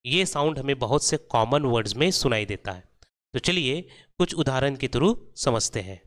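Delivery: ordinary talk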